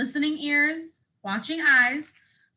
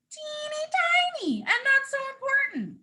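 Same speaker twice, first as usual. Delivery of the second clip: put-on much higher, tiny squeak for teeny; tiny squeaky voice; 12 semitones higher than usual. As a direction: shrink your voice to a little squeak. Voicing the word tiny.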